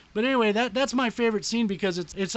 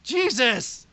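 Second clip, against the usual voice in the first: a sudden loud, high "Jesus!"; startled exclamation; shocked; 6 semitones higher, 8 dB louder than usual. Real, not acted. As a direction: blurt it out in disbelief. Shocked reaction to the news.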